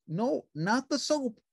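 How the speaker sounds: high, bright puppet voice; cheerful little puppet; Ernie voice. A narrator's reading. Narrator voicing Ernie answering the phone, high puppet voice.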